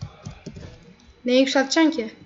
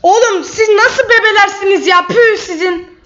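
raised, heated gamer rant; real angry shouting; 5 semitones higher, 4 dB louder than usual. Real, not acted. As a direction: shout in disgust at the opponents. Schimpft im Spiel über Gegner.

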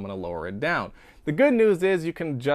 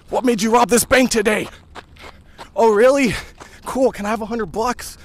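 loud, high, chasing interviewer; pushy reporter; 10 semitones higher, 8 dB louder than usual. Pretend. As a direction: shout questions at a fleeing robber. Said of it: "maybe I'm chasing down somebody".

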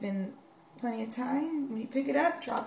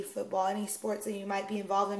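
ordinary delivery

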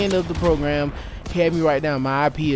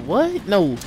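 high, incredulous mock outburst; shocked refusal; 5 semitones higher than usual. Customer retelling his reaction to the hefty fee, incredulous. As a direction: act out balking, pitch jumping up. Retold reaction to scammer's price.

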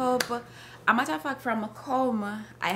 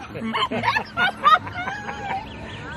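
high laugh. Real, not acted. Laughing at never coming up.

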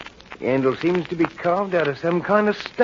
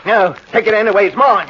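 loud, raised voice; angry refusal; 4 semitones higher, 6 dB louder than usual. Acted. Henry refusing Millie the knife, loud and angry. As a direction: refuse her forcefully.

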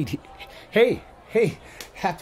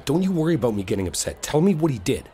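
normal talking voice

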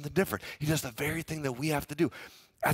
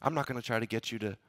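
humble reply; 5 semitones lower, 7 dB quieter than usual. Retold or imitated. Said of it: "Joseph replied to Pharaoh".

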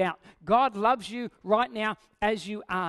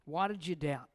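normal talking voice

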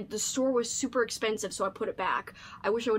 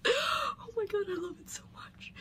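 high tearful voice fading to a whisper; choked-up, whispered gratitude; getting teary-eyed; 8 semitones higher, 4 dB quieter than usual. Real, not acted. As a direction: overcome with happy tears, voice giving out. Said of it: "I'm actually getting teary-eyed".

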